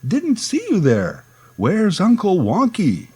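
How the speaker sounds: high, hammed-up clown surprise; mock surprise. Clown pretending to spot kids, hammed-up surprise. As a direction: act amazed to find the kids there. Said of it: "act very surprised and say".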